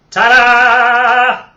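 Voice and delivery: loud, high showman's reveal; triumphant ta-da. Acted. George showing off his jar, loud and triumphant. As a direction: present it like a magic trick.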